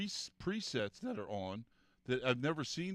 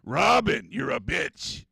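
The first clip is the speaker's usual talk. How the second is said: mock radio shock-jock jab, higher and louder; Howard Stern; 9 semitones higher, 12 dB louder, slower than usual. Host joking on his podcast, mock shock-jock jab. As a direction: throw a cheeky on-air insult. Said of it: "Does I sound like Howard Stern?".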